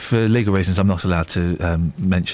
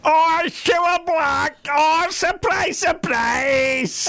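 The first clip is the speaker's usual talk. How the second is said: screechingly high, stretched-out Scouse impression; hammed-up, very high TV host catchphrase; much slower than usual. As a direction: shriek it in a put-on Scouse falsetto.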